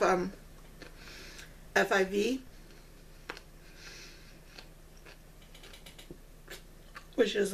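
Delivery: muffled chewing speech; talking with mouth full. Real, not acted. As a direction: hand covering mouth. Seen 0: Eats snack before; hand covers mouth from 1.1s while talking.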